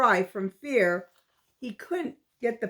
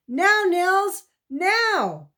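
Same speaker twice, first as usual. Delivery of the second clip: urgent order; 9 semitones higher, 4 dB louder, much slower than usual. A narrator's reading. Boy orders trigger pulled.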